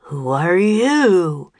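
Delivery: slow, drawn-out demand. A narrator's reading. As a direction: demand it like an imposing wizard. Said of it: "he demanded".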